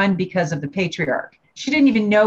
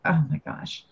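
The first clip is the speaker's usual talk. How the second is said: breathy, much softer gasp of dismay; a stunned, barely voiced oh my gosh; hushed shock; 4 semitones lower, 7 dB quieter than usual. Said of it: "And I was like".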